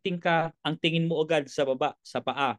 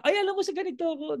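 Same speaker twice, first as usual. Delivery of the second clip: fast, high chatty friend; 10 semitones higher, much faster than usual. Acted. Friend explaining his work, fast and high. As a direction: rattle it off high and quick.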